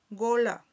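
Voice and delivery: gola with a wide open first o. Shown as a demonstration. Teacher models the word, gola with an open O. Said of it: "Que es una O abierta".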